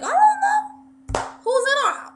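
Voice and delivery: loud, high New York mom; New York accent. Retold or imitated. Mom answering her son, shrill-high New York accent. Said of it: "she has a New York accent".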